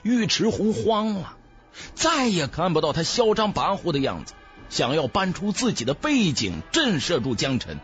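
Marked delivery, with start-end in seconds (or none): none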